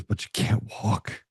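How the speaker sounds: slow, breathy hushed question to the man; hushed probing question. Retold or imitated. Speaker on stage asks man in wheelchair, hushed and slow. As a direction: lean in and ask it gently, half-whispered. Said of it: "And he goes".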